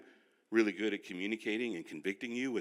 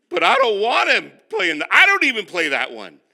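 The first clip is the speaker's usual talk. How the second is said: indignant outburst; 10 semitones higher, 17 dB louder than usual. Acted. Possessive about his guitars.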